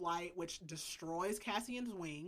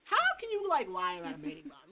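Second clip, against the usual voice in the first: high, loud disbelief; incredulous outrage; 12 semitones higher, 5 dB louder than usual. Real, not acted. Recapper protesting a character's lie, incredulous. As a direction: pitch shoots up, exasperated.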